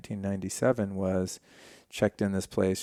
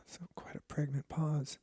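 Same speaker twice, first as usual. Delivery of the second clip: soft breathy whispered aside; 6 semitones higher, 9 dB quieter than usual. Real, not acted. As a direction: whisper it after a sigh. Whispered aside after long pause.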